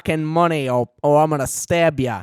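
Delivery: a loud hammed-up British mugger shout. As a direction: bark like a street thug. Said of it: "some mugger walking down the street is like".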